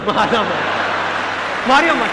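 rising panicky shouts to stop a fight; bystander yelling. Retold or imitated.

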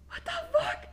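very high startled what the fuck; shocked squeal. Retold or imitated. Girlfriend learning the pet was stolen, high shocked squeal. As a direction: shriek it in pure disbelief.

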